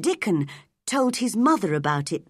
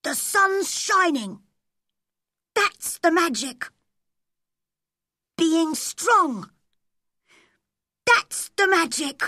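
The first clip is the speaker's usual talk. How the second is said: put-on very high boy's incantation; chant-like spoken plea, pitched far up and building; Colin; 12 semitones higher, slower than usual. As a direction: call on the magic in short, separated phrases. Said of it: "Colin repeated these words several times".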